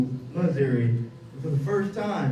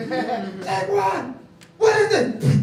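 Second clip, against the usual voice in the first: high, loud alarmed cry; mock panic; 8 semitones higher, 5 dB louder, much slower than usual. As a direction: kid spotting furniture flying. Kid dodging thrown furniture.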